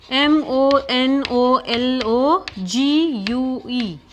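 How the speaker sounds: slow, letter by letter; spelling out. Shown as a demonstration. Teacher spelling a new word for students, slowly letter by letter. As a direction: spell it out with each letter drawn out.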